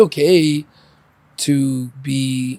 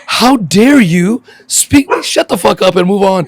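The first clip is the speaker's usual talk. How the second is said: outraged customer; 6 semitones higher, 7 dB louder than usual. Retold or imitated. Diner insulted by the server, loud mock outrage. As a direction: blow up like an offended diner. Offended customer at trash-talk restaurant.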